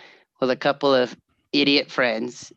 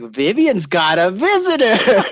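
slow, higher, louder sing-song announcement; slow sing-song announcement, pitched up; teacher announcing; 6 semitones higher, 5 dB louder, slower than usual. Retold or imitated. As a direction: tease the surprise to the class. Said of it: "I could hear him".

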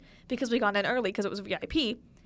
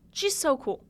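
bright, louder "She's so cool" with a pitch lift; excited gush; 4 semitones higher, 4 dB louder than usual. Real, not acted. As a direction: say it out loud, gushing. Repeats praise, whispered then aloud.

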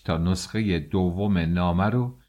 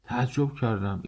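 usual speaking voice

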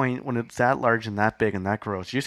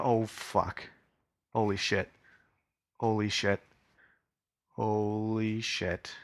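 stunned disbelief; 4 dB quieter, slower than usual. Acted. Stunned by lights outside the window.

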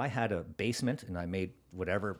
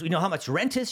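higher, louder challenge; berating interrogation; 8 semitones higher, 5 dB louder than usual. Retold or imitated. Program lady quizzing a would-be store owner, berating. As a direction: imitate a tough boss firing off a question. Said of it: "That's exactly how she spoke".

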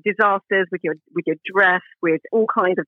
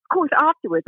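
ordinary talk, nothing marked

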